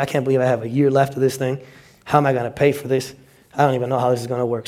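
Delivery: hushed and fast, muttering worry to yourself; a student fretting under his breath about debt; quiet, rushed self-talk; worried inner thoughts. Pretend.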